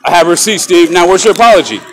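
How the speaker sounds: loud, furious demand for an apology; angry shout. Real, not acted. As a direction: yell it at the guard, fed up. Confronting the guard, demanding apology.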